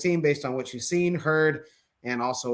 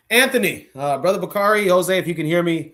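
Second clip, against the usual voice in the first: louder, higher calling voice; 4 semitones higher, 6 dB louder than usual. Real, not acted. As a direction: call out to check who's there. Host calling guests who may be gone.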